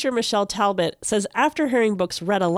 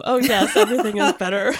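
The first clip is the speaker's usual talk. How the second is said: laughing agreement; 2 semitones higher, 3 dB louder than usual. Real, not acted. Radio host agreeing with her guest, laughing lightly. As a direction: laugh through a quick yes.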